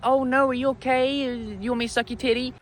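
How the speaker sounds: high, sweetly soothing put-on voice; drunk too-nice guy. To a crying lady at the bar, a drunk guy's high, syrupy concern. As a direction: coo it like a sloppy drunk playing the gentleman.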